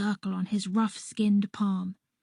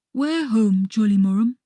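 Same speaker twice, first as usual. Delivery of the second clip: loud, halting character voice; forceful question in simple foreign-sounding speech; Harby's voice; 5 dB louder than usual. A narrator's reading. Narrator voices Harby's broken-English question.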